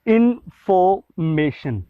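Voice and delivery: इन्फॉर्मेशन drawn out slowly, r dropped; silent r, slow. Shown as a demonstration. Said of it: "इन्फॉर्मेशन में आर हमने इसलिए नहीं पढ़ा". (धीरे से इन्फॉर्मेशन, आर ख़ामोश)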